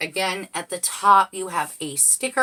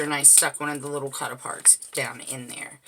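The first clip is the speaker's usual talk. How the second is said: whisper; 4 semitones lower, 7 dB quieter than usual. Real, not acted. Whispered aside while crafting.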